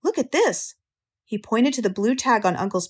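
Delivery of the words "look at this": put-on high, swooping child's exclamation; excited higher-pitched call; excited cry. A narrator's reading. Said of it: "cried Avery".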